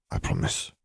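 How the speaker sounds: soft, almost whispered murmur. A narrator's reading. Fleur promising her partner, in a soft murmur. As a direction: murmur it barely voiced. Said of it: "Fleur murmured".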